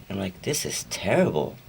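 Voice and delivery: usual speaking voice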